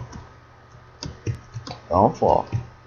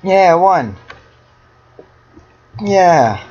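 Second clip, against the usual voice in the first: loud, high victory shout; real loud, high-pitched celebration; triumphant cheer; 9 semitones higher, 5 dB louder, slower than usual. Real, not acted. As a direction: crow over the win. Wins a round.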